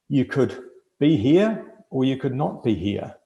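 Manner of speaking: normal talking voice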